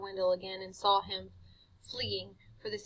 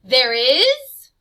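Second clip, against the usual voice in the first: growl; 4 semitones higher, 17 dB louder than usual. Acted. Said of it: "Harvey growled at this".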